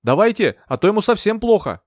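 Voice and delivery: high-pitched, pressing call; urgent shout. A narrator's reading. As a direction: call out to hurry him. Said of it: "крикнула я".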